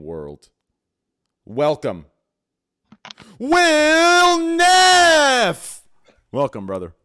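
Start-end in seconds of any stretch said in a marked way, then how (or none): 1.4-6.9 huge high drawn-out announcer yell; hype intro shout. Pretend. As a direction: scream the name like a wrestling announcer. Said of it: "that was a very nice intro".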